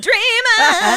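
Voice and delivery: loud, high jeering cry; jeering at the note, loud and high; mock shout. Real, not acted. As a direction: throw it back as a loud jeer. Friend mocks the note loudly.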